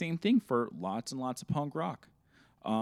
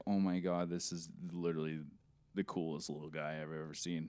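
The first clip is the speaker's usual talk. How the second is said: hushed awe; 7 semitones lower, 9 dB quieter than usual. Retold or imitated. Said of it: "you're like".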